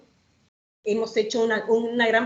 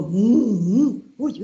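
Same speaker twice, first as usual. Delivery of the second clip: mooing cow; 5 dB louder, much slower than usual. Acted. Cow voice in the rhyme.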